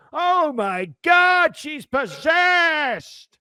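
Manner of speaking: very high, long drawn-out shriek; hammed-up horror, wailing every word; horrified priest. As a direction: play a scandalized priest in mock panic. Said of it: "the priest looks at her, and he goes".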